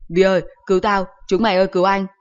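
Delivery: loud, frantic cry for rescue; panicked shout for help. Acted. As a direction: yell for help while pinned down. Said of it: "hốt quá hét ầm lên".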